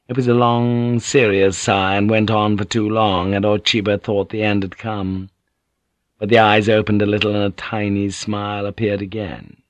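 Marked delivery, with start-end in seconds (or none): none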